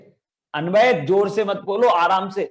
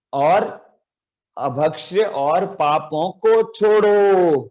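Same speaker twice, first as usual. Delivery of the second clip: slow, drawn-out chanted recitation of a poem line; slower than usual. Shown as a demonstration. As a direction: recite it slowly for children to echo. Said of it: "ऐसे बोलना पड़ता है". (धीमा, खिंचा हुआ पाठ)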